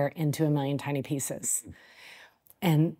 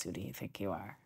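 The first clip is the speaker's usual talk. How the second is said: much lower and softer, like a voice inside your head; inner critic voice; 7 semitones lower, 15 dB quieter than usual. Retold or imitated. The inner voice doubting herself, low and hushed. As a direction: murmur the doubt to yourself. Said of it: "the one inside that says".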